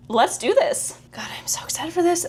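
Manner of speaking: breathless whisper, barely containing excitement; hushed thrill before the trailer starts; excited whisper. Real, not acted. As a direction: whisper it, buzzing with anticipation.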